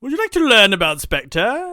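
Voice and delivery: high, loud, theatrical question; put-on spy-skit voice. Host offering Bond intelligence on Spectre, high put-on voice. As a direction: pitch it high like a hammy spy-film character. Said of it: "why I'm talking like this".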